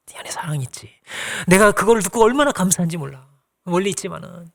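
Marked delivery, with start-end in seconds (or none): 0.1-0.9 soft tender whisper. Acted. Paul whispering love to Philemon, softly. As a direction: breathe it gently like a secret.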